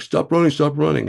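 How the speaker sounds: high, loud barked order; shouting agents. Retold or imitated. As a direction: yell it like masked agents chasing her.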